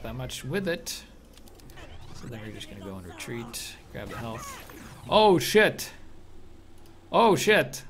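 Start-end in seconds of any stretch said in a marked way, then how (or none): 5.1-7.8 alarmed exclamation. Real, not acted. Sudden danger in game.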